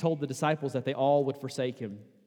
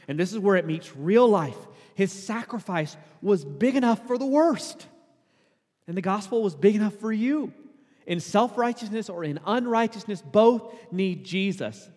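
louder, higher impassioned preaching; preaching at full force; 7 semitones higher, 7 dB louder than usual. Acted. Pastor pressing the gospel on the congregation, impassioned. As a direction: drive it home to the congregation.

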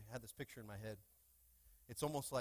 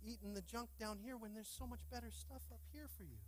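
high, soft, pleading call; God coaxing his child to fly, pleading; 8 semitones higher, 5 dB quieter than usual. Acted. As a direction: plead gently from above. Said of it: "he's going like".

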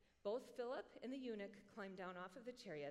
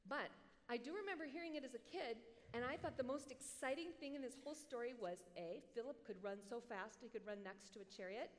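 ordinary delivery

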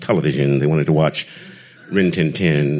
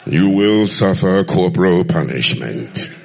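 booming father; 5 semitones higher than usual. Retold or imitated. Father booms at his son that he will deliver the speech. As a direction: thunder it like a stern pulpit father.

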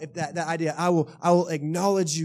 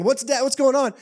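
higher and louder, startled questions; alarmed son; 9 semitones higher, 8 dB louder than usual. Retold or imitated. Son asking what the screaming is, startled. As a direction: sound like a kid scared by the noise.

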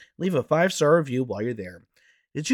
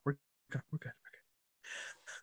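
softer and softer to a whisper; fading out; 19 dB quieter, slower than usual. Real, not acted. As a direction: let it fade to a whisper. Repeating, fading to nothing.

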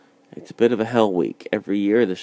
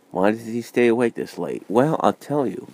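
ordinary talk, nothing marked

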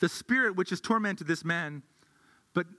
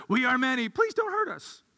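squeaky, much higher whimpering plea; mock pleading demon; 5 semitones higher than usual. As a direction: play the scared demon for laughs. Mocking demon begging Jesus.

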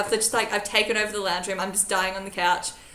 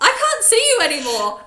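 high, loud hammed-up alarm; mock panicked cry; 12 semitones higher, 6 dB louder than usual. Patient about to faint calls to housemate, high mock panic. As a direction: wail it as if about to pass out. Retold moment before fainting.

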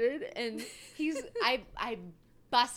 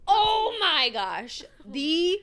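slow, loud, dramatic reveal; dramatic announcement; 4 semitones higher, 8 dB louder, much slower than usual. Acted. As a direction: announce it like breaking doom.

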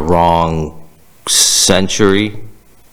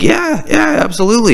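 excited high yes. Retold or imitated. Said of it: "I'm like".

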